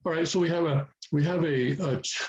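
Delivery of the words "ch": "ch" as a bare puff of air; aspirated ch. Shown as a demonstration. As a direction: release "ch" alone, breathy and voiceless. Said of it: "Aspirating that first one".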